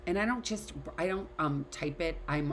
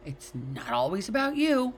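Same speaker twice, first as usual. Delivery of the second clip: quoting J F; 5 semitones higher, 5 dB louder than usual. Retold or imitated. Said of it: "J F likes to say to me".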